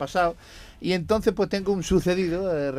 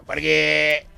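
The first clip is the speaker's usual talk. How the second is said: Basque man voice; 6 semitones higher, 11 dB louder than usual. Acted. Second Basque answers in joke.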